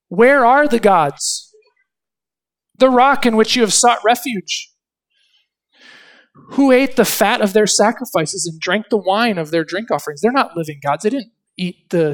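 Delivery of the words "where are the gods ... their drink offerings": a pulpit voice of divine reproach; preaching God's challenge. Acted. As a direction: demand where the false gods are. Lord's challenge read out.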